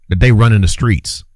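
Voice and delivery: ordinary delivery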